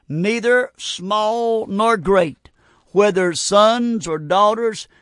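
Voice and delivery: slow, measured Bible reading; solemn preacher quoting verse; scripture reading. Acted. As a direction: read the verse slowly, letting each phrase land. Reads scripture aloud slowly.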